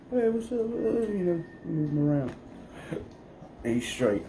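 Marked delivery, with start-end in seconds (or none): 0.1-2.4 mock rough greeting. Showing how the cat likes being handled, high playful greeting. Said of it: "You have to be like".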